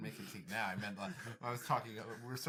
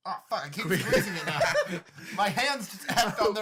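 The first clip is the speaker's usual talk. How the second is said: loud, laughing through the words; 7 semitones higher, 9 dB louder than usual. Real, not acted. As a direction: laugh while you talk. Keeps squeezing drink, laughing.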